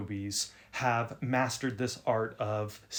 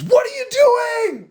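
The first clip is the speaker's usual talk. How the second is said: loud, high shocked yell; startled shout; 12 dB louder, slower than usual. Retold or imitated. Stranger jumps up and shouts at the boy in surprise. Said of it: "jumps up and goes".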